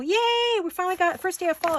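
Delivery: a sudden high squeal of joy; excited cheer. Real, not acted. Greeting the first fall day with a joyful yay. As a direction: cheer for the cool weather.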